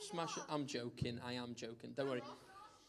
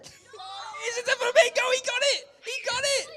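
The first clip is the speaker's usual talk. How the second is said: excited shout; 12 dB louder than usual. Real, not acted. Blindfolded player wins.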